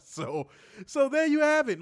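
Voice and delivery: high, loud laughing words. Real, not acted. Host cracking up at his own joke, laughing. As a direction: laugh through the line.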